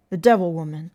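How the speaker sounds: forceful, loud accusing cry; shouted accusation. A narrator's reading. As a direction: throw the name out loudly. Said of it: "Trout shouted".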